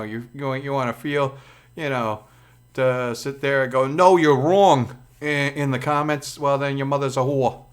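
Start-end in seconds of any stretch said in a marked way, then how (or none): 3.9-4.9 loud, drawn-out mock contradiction; mock objection. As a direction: imitate an argumentative commenter, slow and loud. Mimics a contrary commenter.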